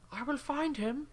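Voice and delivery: high, intense villain threat; angry villain. Retold or imitated. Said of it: "He's quite angry though".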